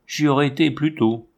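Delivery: usual speaking voice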